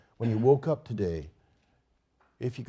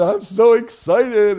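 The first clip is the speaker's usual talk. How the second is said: much higher, louder, slow gushing; excited; 11 semitones higher, 14 dB louder, slower than usual. Retold or imitated. Sara meeting the camp pastor she researched, gushing. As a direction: stretch it out beaming with joy. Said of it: "and she said".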